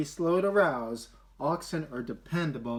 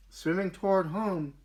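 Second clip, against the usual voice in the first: ordinary delivery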